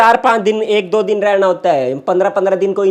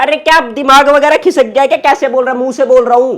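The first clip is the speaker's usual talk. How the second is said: high, raised exasperated voice; angry retort; 6 semitones higher, 5 dB louder than usual. Acted. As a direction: hurl it back, out of patience. Snapping back in staged quarrel.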